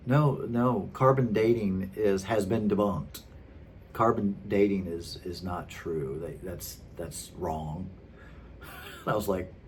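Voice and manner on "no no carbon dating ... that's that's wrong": retold matter-of-fact dismissal, trailing lower and softer. Preacher's follower rejecting carbon dating, matter-of-fact. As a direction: imitate someone casually sure of himself. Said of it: "he just said it so matter-of-factly".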